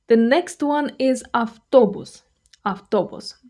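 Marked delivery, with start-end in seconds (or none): none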